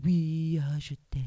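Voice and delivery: low, soft mock-swooning; lovestruck Romeo. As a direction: imitate a suitor murmuring his love. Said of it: "Roméo". (Roméo énamouré, voix grave et douce)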